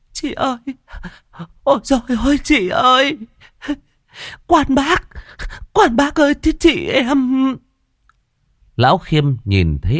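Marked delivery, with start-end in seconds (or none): 0.1-7.6 wailing lament. Acted. Younger sister wailing over her dead sister's coffin. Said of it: "ôm chầm lấy mà khóc".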